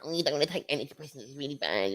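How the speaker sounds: quick, high mocking quote; mocking know-it-all. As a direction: lightly mimic a preachy busybody. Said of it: "anyone that gives you all that".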